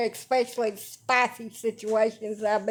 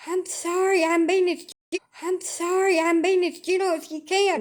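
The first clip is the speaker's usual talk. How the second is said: high, sing-song apologetic nurse; mock nurse apology; 7 semitones higher than usual. Nurse apologising to patient while stabbing, high mock sweetness. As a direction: mimic a nurse fussing over you.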